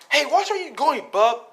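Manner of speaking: high, loud, annoyed yell; irritated call-out. Acted. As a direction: snap at someone who bumped into you. Short-tempered Mitsuki snaps at Bak.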